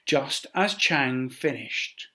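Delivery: plain reading voice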